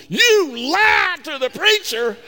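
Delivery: wife can't believe he lied to the preacher, shouting; shocked shout. Retold or imitated. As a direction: shriek it in disbelief, stretching every word. Wife's shocked outburst in car.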